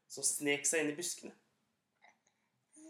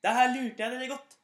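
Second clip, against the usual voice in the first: gloating; 6 semitones higher, 8 dB louder than usual. Acted. Gutten ler av landsbyfolket.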